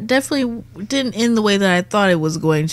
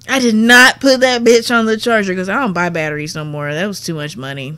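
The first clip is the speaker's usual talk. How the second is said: usual speaking voice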